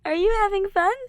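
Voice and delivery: high, loud tipsy cheer; tipsy Ash. Acted. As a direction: slur out giddy questions. Drunk Ash.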